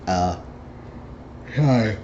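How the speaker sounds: ordinary talk, nothing marked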